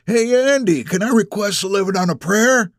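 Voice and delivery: put-on high-pitched old man calling out; old man shouting. A narrator's reading. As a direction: shout a request across the room. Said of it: "One of the old men shouts out".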